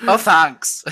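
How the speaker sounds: put-on teasing echo of a California accent; mimicked California accent. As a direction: parrot it back in exaggerated Californian. Mimics the other's accented reply.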